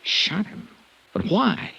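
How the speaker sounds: shocked. Acted. Shocked reaction to murder news.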